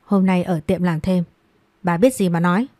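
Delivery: usual speaking voice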